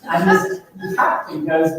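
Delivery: normal talking voice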